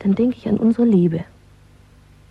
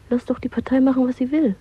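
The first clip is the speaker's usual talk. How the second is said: ordinary delivery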